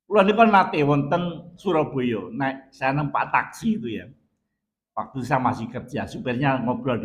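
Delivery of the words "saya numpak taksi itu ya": normal talking voice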